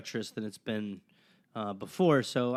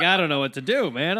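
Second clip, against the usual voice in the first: louder and higher, half-laughing despair; mock dismay; 6 semitones higher, 9 dB louder than usual. Podcaster retelling his reaction to a dark episode, louder mock dismay. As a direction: throw up your hands at the dark episode.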